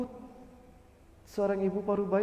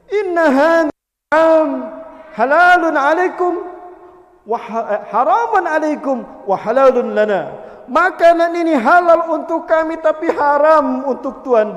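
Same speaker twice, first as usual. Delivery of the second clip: slow, loud, higher chant-like line; declaimed Arabic; 5 semitones higher, 12 dB louder than usual. Acted. Widow answers the shoemaker, slow loud declaiming. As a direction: weigh each word solemnly.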